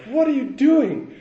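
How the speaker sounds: high, loud, slow incredulous challenge; exasperated self-rebuke. Retold or imitated. Looking back at himself moving home, loud incredulous challenge. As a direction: demand it of your younger self in disbelief. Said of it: "I look back and I was like".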